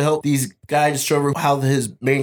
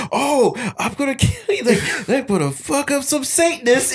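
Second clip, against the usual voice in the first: higher, put-on menacing voice; mock tough guy; 8 semitones higher than usual. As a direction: threaten them in a silly boxer voice. Tyson facing Satanists.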